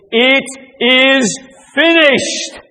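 high, drawn-out cry from the cross. Retold or imitated. Jesus on the cross after tasting vinegar, crying out. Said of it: "the way he cried out".

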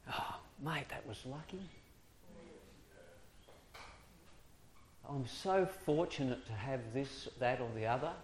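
lightly voiced casual chatter. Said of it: "hearing Christians going".

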